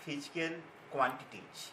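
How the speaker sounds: very slow, spaced teacher dictation with long pauses. Acted. Teacher dictating the scalar definition to students, word by word, very slowly. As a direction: read it out slowly so students can write.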